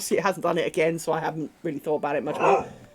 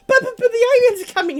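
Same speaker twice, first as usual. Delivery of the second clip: much higher, louder, fast stammering alarm; desperate panic; 9 dB louder, much faster than usual. Retold or imitated. The brother dog begging Amy to fall for it, frantic fast stammer. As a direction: babble it out, pleading for her to believe him. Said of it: "And he's like".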